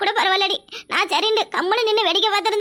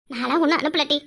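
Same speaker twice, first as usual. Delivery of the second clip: ordinary talk, nothing marked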